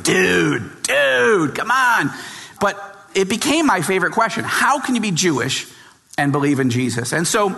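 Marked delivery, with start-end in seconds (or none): 0.0-2.1 pleading dude-style disbelief; mock dude host. Talk show hosts pressing the author, a hint of surfer dude. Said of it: "flowery a way of".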